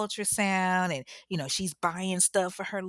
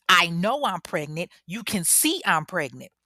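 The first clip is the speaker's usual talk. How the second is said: louder, forceful acted protest; retold anger at usual pitch; enraged insistence; 4 dB louder than usual. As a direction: insist angrily, punching the words. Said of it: "rage, you know, Tasha is pissed".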